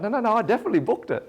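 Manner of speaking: fast, loud, high defensive denial; flustered protest. Retold or imitated. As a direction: scramble to defend yourself. Defending herself to her father.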